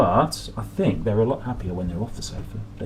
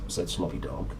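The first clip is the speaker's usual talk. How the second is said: plain reading voice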